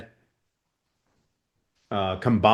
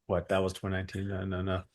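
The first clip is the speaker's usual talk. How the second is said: much softer, under the breath; thinking aloud; 2 semitones lower, 12 dB quieter, faster than usual. Real, not acted. Coach working out the year to herself, thinking aloud quietly. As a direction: drop to a quick, quiet aside to yourself.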